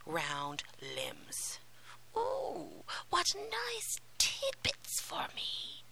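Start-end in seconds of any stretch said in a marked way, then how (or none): 2.2-5.8 high, drawn-out witchy murmur; murmuring witch. A narrator's reading. Witch gloats over the sleeping children, murmuring. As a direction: murmur it greedily, high and slow. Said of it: "murmured she".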